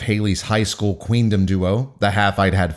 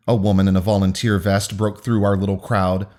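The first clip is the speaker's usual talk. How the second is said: ordinary delivery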